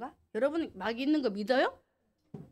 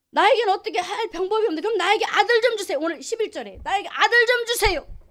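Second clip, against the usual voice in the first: raised, earnest pleading prayer; a desperate mother's vow, loud and urgent; Hannah praying; 7 semitones higher, 7 dB louder than usual. Acted. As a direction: beg God for a son, fervently. Preacher voices Hannah's prayer.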